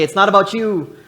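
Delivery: loud call to the congregation; call to neighbor. Shown as a demonstration. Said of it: "Turn to your neighbor and say".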